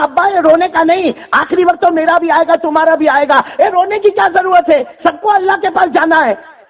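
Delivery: high daughter's voice, comforting. Retold or imitated. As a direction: gently reassure the old father. Daughter comforts dying father. (بیٹی کی اونچی تسلی بھری آواز)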